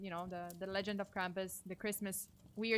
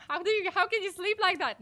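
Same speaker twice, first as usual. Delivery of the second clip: high, loud disbelieving protest; exasperated shout, pitched way up; incredulous outburst; 12 semitones higher, 11 dB louder than usual. Real, not acted. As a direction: yell at them in playful disbelief. Outburst at viewers who'll sleep fine.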